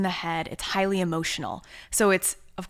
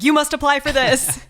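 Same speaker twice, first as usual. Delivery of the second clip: high, loud insistent urging; excited friends; 8 semitones higher, 9 dB louder than usual. Retold or imitated. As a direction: push them to do it, all excited. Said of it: "were like".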